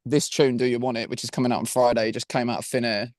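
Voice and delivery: ordinary talk, nothing marked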